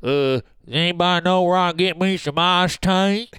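hammed-up country twang; mock Southern twang. Jokingly asking around for iced tea, mock Southern twang. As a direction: put on a thick Southern accent. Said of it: "if you sound like that".

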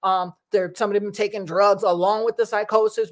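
ordinary talk, nothing marked

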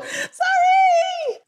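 very high, drawn-out playful "sorry"; wailed mock apology. Guest apologising to hosts for skipping wine, mock wail.

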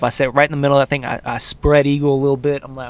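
ordinary talk, nothing marked

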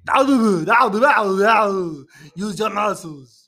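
loud, high strained Austrian strongman; Arnold Schwarzenegger. Acted. Host doing his best impression, loud straining Austrian strongman. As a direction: grunt and exhort in a hammed-up Austrian accent. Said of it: "Arnold Schwarzenegger".

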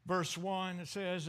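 usual speaking voice

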